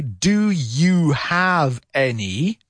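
"Do you have any?" stretched out, each word clear; said slowly. Shown as a demonstration. Teacher models the slow version for students, very slow. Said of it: "If I say it slowly".

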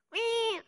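put-on high squeaky baby "uii"; a short high baby squeal from the back; mock baby whimper. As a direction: mimic a tiny baby's cry, high up. Imitating a baby crying in church.

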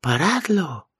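high, loud desperate yell; shout. A narrator's reading. Mikey begs the men torturing him to stop, shouting. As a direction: scream for them to stop.

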